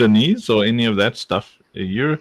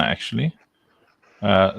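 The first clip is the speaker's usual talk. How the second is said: usual speaking voice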